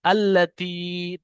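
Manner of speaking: আল্লাতি said slowly with a doubled lam; slow model word. Shown as a demonstration. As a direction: stretch the ti in আল্লাতি. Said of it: "আল্লাতি পড়বেন".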